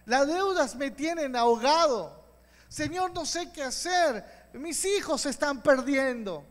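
high, loud cries to God, building to a shout; fervent pleading prayer. Shown as a demonstration. Preacher modelling a prayer for the listener, fervent loud pleading. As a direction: beg the Lord for rescue with everything. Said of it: "Comienza a clamar a Él diciendo".

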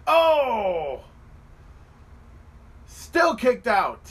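loud, high, drawn-out shout; amazed outburst. Real, not acted. Fan watching a kick-out from the second pedigree, amazed shout. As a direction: cry out, then slow down in disbelief.

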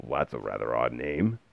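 low, soft grumble; grumpy low remark; grumbled. A narrator's reading. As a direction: grumble it well down in pitch. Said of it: "the man grumbled".

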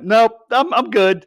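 squeaky "nope, I'm good"; mock refusal. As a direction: back away in mock disgust. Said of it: "And I'm like".

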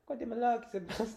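a hint of an eager doctor. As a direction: ask kindly like a doctor. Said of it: "ele não falava meio assim".

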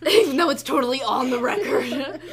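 talking through hysterical laughter; squeaky, loud, laughing words. Real, not acted. Laughing fit while talking.

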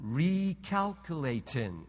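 low, slow flat machine voice; robotic GPS voice. Retold or imitated. Preacher voicing the GPS to the congregation, low slow sat-nav voice. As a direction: sound out each syllable like a sat-nav. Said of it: "It says".

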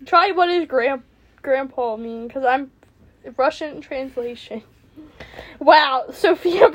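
ordinary delivery

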